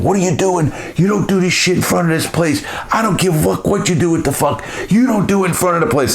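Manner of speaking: imitated angry boss telling him off; Dominic scolding.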